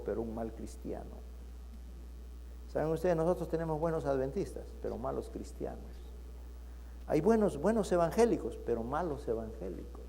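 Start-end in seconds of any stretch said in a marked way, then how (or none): 0.0-1.2 much softer and lower on the last words; low hushed punchline. Acted. Priest correcting the nuns, quiet grave punchline. 4.8-6.0 much softer and lower, grave; low hushed moral. Acted. Pastor warning the church, hushed grave moral. Sermon moral after story. 8.6-10.0 malos evangélicos much softer and lower, malos stressed; dropped grave contrast. Acted. Pastor contrasting good and bad believers, hushed stress on malos.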